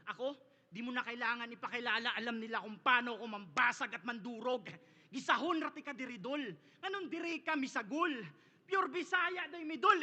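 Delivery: ordinary talk, nothing marked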